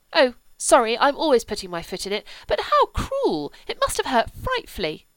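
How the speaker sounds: high, loud and pained; upset child. A narrator's reading. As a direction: cry out in pity. Cordelia's upset reaction.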